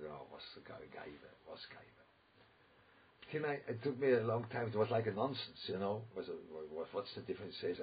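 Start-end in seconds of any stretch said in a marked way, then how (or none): 0.0-3.3 hushed, baffled mutter of confusion; puzzled whisper. Retold or imitated. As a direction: whisper it like you can't make sense of the word. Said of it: "I was looking like that".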